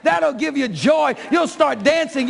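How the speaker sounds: ordinary talk, nothing marked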